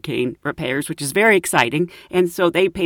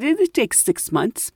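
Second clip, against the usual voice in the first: slow, slightly higher pompous reply; pompous highbrow man; 4 semitones higher than usual. Retold or imitated. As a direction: lightly voice a highbrow shopkeeper. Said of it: "He's a little bit of a pompous man".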